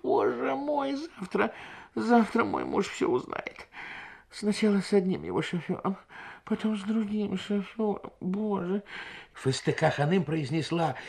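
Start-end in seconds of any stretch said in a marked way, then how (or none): 0.0-8.8 higher, moaning woman's lament. A narrator's reading. Wife laments to the guests, higher and moaning. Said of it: "проговорила со стоном".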